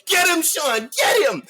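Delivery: high, loud cheering shout; teen fan shouting at the TV; excited shout. Retold or imitated. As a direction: yell at the screen for Sean. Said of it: "I was like".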